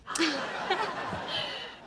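high chuckle; cheeky laugh. Real, not acted. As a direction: laugh at your own joke. Laughs at own flirt joke.

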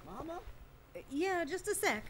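high, distant voice; calling from afar. Acted. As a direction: answer from another room. Heard from another room.

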